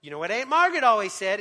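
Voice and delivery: mock needling told-you-so; nagging parent. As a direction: pick at them with a loud, fast nag. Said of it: "come alongside and go".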